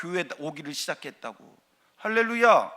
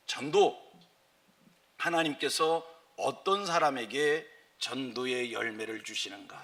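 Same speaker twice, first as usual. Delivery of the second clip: normal talking voice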